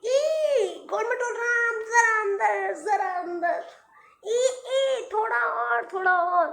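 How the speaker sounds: high, strained grunting push; straining old cobbler. A narrator's reading. Old cobbler straining to push the shoe on. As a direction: squeeze the shoe on with effort.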